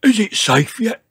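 loud, high, puffed-out question; panting. A narrator's reading. Dinosaur after the climb, loud panted question. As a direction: ask it as if out of breath. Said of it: "panted George".

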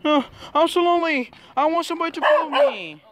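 high, pleading puppy voice; lonely dog voice. Pretend. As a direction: play a sad lonely dog begging. Kid voicing a lonely dog.

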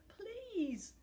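a last begging word; pleading. A narrator's reading. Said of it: "he pleaded".

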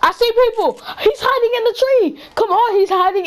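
excited callout. Real, not acted. Alerting the squad to an enemy in a tree, excited. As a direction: blurt it out, buzzing with urgency. Spotting enemy mid-game.

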